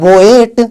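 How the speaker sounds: बोएट split as "bo-et", both vowels sounded; deliberately wrong two-vowel बोएट; two vowel sounds. Shown as a demonstration. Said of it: "दो अलग अलग sound होना चाहिए".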